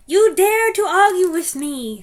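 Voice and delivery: put-on high, loud accented scream; screaming witch. Grand High Witch screaming at the arguing witch. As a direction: scream the accusation. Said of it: "screamed the Grand High Witch".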